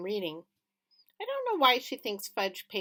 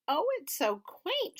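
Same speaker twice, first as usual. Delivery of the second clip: much higher, slow and gushing; delighted; 11 semitones higher than usual. A narrator's reading. Mom charmed by the little town, gushing. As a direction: coo it, charmed. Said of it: "she said".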